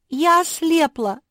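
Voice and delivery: very high, loud wail; panicked cry. A narrator's reading. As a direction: cry out in alarm, as a little sister. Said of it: "закричала самая младшая сестра". (испуганный высокий крик)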